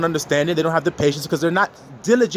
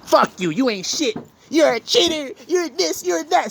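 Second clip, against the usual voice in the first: higher, scornful name-calling; mock insulting rant; 9 semitones higher than usual. Judging spouse hurling labels at partner, mock scornful. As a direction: sneer the labels at them.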